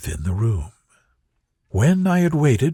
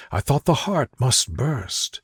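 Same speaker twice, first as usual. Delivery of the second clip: plain reading voice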